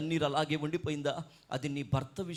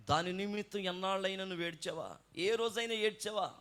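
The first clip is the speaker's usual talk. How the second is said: ordinary talk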